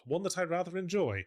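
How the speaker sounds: usual speaking voice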